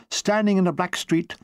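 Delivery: ordinary delivery, nothing marked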